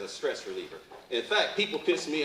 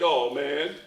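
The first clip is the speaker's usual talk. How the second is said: hammed-up wail, rising louder and higher to a drawn-out finish; mock tearful lament; 5 semitones higher, 7 dB louder, slower than usual. Crying husband explaining to the men in heaven, mock tearful lament. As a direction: act out a crying man's complaint.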